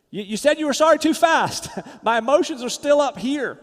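loud, high, indignant objection with a laugh; worked-up protest. Acted. Spouse not ready to forgive after a hasty apology, worked-up protest. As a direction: protest loudly, pitch up, half laughing. Acted unready reply to an apology.

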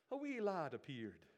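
light playful Irish lilt on "A wee lad appeared"; a quick mock Irish storyteller voice; Irish accent. Preacher jokes in a light Irish accent to the congregation. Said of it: "Sounds like an Irish story".

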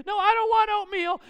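put-on high, loud whining kid yell; mock tantrum. Kid refusing dad's toast at breakfast, mock tantrum yell. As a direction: imitate a child shouting a refusal.

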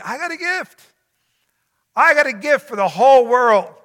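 preacher lifting his voice as Jesus; exultant Jesus voice. Acted. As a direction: proclaim it like good news from above. Preacher voicing Jesus, lifted high.

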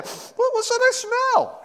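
high, startled sniffing question; squeaky mock alarm at a smell; mock surprise. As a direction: play it like you just caught a strange smell. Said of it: "kind of thing".

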